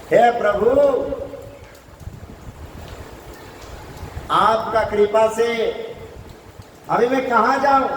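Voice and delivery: high-pitched desperate entreaty; pleading serpent. Acted. Kaliya asks the Lord where to go, pleading high. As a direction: cry out to the Lord in a high, declaimed voice. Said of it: "वो भी हाथ जोड़ करके कहने लगे".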